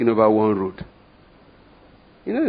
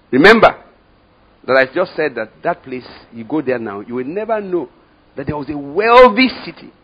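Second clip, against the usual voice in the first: preaching cry; 5 semitones higher, 9 dB louder than usual. Acted. Preaching at full force.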